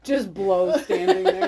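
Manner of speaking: loud, slow, laughing frat bro; frat boy voice. Acted. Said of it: "you sound like a frat boy right now".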